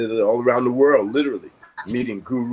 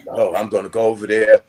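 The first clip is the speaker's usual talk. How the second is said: louder, heated threat retold; retold angry outburst, louder; 8 dB louder than usual. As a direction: fume it out like you're about to storm off. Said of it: "I'm angry and I'm mad".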